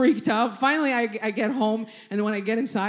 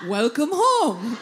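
butler ghost; 2 semitones higher, 4 dB louder, much slower than usual. Acted. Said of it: "Like a butler ghost".

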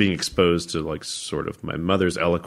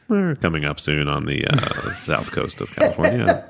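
calm, higher cabin announcement voice, laughing through it; airline pilot voice; 8 semitones higher than usual. Retold or imitated. Son mimics his pilot dad's cockpit announcement, calm pilot voice. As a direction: play the captain on the intercom. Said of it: "the way most airline pilots would talk".

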